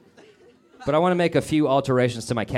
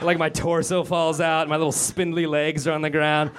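ordinary talk

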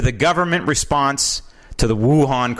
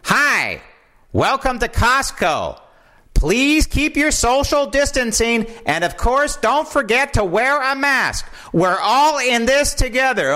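imitating store recording; 11 semitones higher than usual. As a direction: mimic the cheery Costco loudspeaker. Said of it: "But the recording was".

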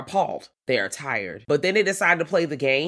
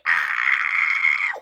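inner scream; 12 semitones lower than usual. Acted. Podcaster recalling first sight of the game, screaming inwardly. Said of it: "I'm internally screaming".

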